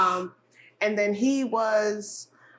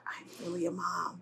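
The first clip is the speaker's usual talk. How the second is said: quiet realization; 11 dB quieter than usual. Retold or imitated. New mom glancing back at her baby driving home, quiet realization. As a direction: say it hushed and slowly, letting it sink in.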